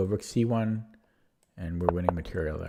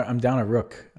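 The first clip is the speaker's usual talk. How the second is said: usual speaking voice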